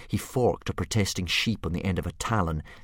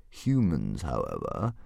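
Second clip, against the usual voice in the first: thoughtful dragon; 6 semitones lower, 3 dB quieter, slower than usual. A narrator's reading. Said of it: "continued the dragon thoughtfully".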